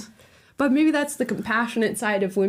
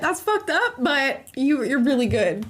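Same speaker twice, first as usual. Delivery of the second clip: excited; 8 semitones higher than usual. Retold or imitated. Excited hypothetical reaction.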